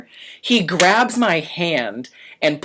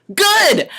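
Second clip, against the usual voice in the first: much higher mock-cheerful; sarcastic good; 5 dB louder than usual. Waiter answering the manager's order, high and sarcastic. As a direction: toss it back cheekily. Sarcastic retort to being sent home.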